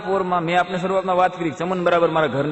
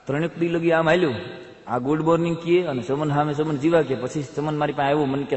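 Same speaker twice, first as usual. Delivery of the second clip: ordinary delivery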